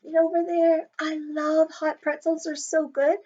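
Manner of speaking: ordinary talk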